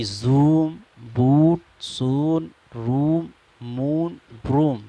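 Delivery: زوم، بوٹ، سون، روم، مون، بروم drawn out slowly with a long oo; slow, careful teacher demo, each word with a clear long oo; long oo vowel demo. Shown as a demonstration. Teacher to students, repeating the examples slowly and clearly. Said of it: "پیش کو معروف طریقے پر پڑھا گیا ہے".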